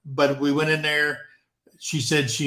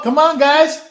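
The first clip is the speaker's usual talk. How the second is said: calling out; 11 semitones higher, 9 dB louder than usual. Real, not acted. Urging viewers to call in.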